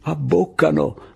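slow, high, gleeful exclaim; delighted the trick is working; old fighter pleased his ruse worked, gleeful; happy. Acted. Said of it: "disse felice il sordo".

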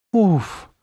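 falling sigh of release; relieved puff. A narrator's reading. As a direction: let out a big "oof". Relief after pulling mask off.

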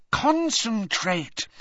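high, drawn-out, emphatic command; insistent urging. A narrator's reading. Hermione pushing Harry to focus, slow and insistent. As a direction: stretch the word out to drive it home.

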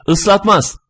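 high, prompt cry; mildly shouted, high-pitched answer; shouted reply. A narrator's reading. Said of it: "ben bağırıyordum".

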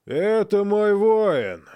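slow, high and loud announcement; loud proclamation. Acted. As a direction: proclaim it to the crowd. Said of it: "громко произнёс Агни".

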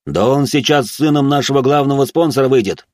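agitated high-pitched yell; heated shout, pitched well up; director shouting. Acted. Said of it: "закричал директор".

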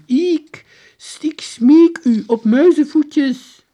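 very high, booming slow plea; overblown, drawn-out soldier's appeal; calling general. A narrator's reading. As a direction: beseech loudly in stiff archaic style. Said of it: "de generaal riep".

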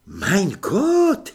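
high, loud vampire exclamation; exclaimed Mein Gott. Acted. Vampire Otto exclaims at the clever guess, loud and high. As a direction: burst out in a German accent. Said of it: "zvolal Otto".

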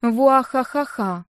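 slow, drawn-out villain cackle; evil laugh. Acted. Evil laugh opens reading. (злодейский хохот)

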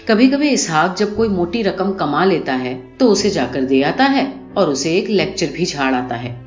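ordinary delivery, nothing marked

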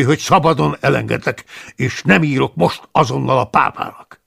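loud, high, furious scolding; angry tirade. Acted. The abbot loudly lists Paulus's offences, furious. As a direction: rail at the disobedient monk.

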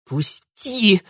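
high, strangled cry to let go; strained plea. Acted. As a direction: struggle against the cane at your throat. Pinned girl demands release. (сдавленный крик)

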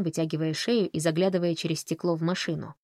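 ordinary delivery, nothing marked

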